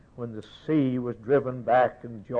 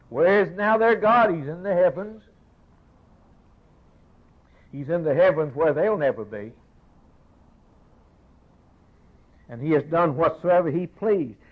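raised, pleading pulpit cry; fervent preaching; 6 semitones higher, 5 dB louder than usual. Acted. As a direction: thunder it from the pulpit, higher and louder. Preaching at full force.

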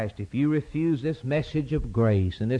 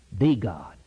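usual speaking voice

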